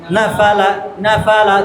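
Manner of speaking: "না ফা লা" run together as one word; low, even drill of "না ফা লা"; joined up. Shown as a demonstration. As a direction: repeat না ফা লা briskly, without gaps. Joined drill after slow take.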